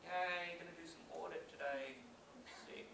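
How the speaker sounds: a quiet, deadpan, sarcastic "yay"; sarcastic mock cheer. Speaker mimics a miserable worker faking cheer, quiet sarcastic deadpan. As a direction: mutter a hollow cheer about the audit. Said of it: "you walk around like this and go".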